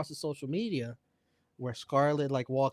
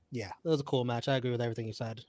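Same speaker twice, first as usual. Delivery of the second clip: usual speaking voice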